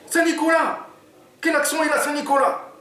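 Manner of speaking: very high, puzzled Oumar voice; baffled sidekick. Acted. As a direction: ask it like he can't believe it.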